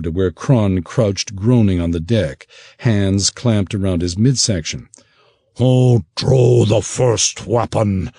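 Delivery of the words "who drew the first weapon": slow, growling beast captain's demand; gnoll demanding. Acted. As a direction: press for an answer like a gnoll. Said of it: "the gnoll demanded".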